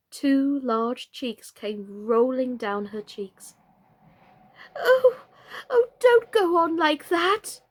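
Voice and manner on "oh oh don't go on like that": high, slow, pleading oh; distressed cry. Acted. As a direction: plead in dismay. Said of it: "cried the poor Queen".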